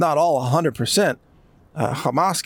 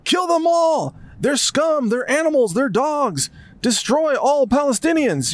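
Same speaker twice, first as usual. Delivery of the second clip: mock bloodthirsty leader; 10 semitones higher than usual. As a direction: caricature a leader calling for slaughter, higher. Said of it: "They're all like".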